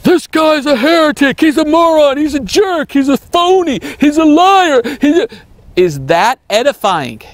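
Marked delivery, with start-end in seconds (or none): 0.0-5.3 high-pitched ranting caricature; hammed-up tirade, pitched way up; mock name-calling. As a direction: imitate a shrill accuser hurling insults. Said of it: "to call people names".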